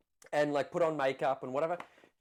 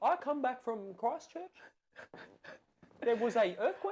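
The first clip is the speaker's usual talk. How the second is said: raised-pitch New Zealand imitation; Kiwi accent; 5 semitones higher than usual. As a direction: put on a Christchurch Kiwi accent. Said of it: "my accent isn't that good".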